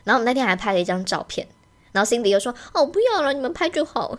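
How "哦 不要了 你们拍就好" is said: higher, lightly voiced imitation of Cindy; imitating Cindy. Imitating a friend refusing the photo, high and light. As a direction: copy a friend's dismissive brush-off, lightly. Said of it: "我现在在学他".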